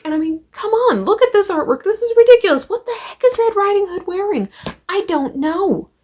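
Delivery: mock the skimpy costume in high-pitched disbelief; incredulous outrage. Real, not acted. Reviewer scoffing at the comic's cover art, incredulous and exasperated.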